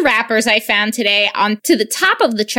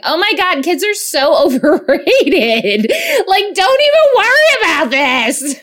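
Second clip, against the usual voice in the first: higher, louder gushing pep talk; gushing reassurance; 7 semitones higher, 3 dB louder than usual. Retold or imitated. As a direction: hype up a crying friend. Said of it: "And I was like".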